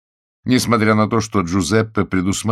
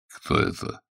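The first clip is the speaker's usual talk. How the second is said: puzzled; 3 semitones lower, 6 dB quieter than usual. A narrator's reading. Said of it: "с недоумением спросил сержант".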